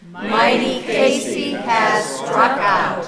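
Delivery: high, loud climactic proclamation; dramatic final line. A narrator's reading. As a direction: deliver the ending with full dramatic force.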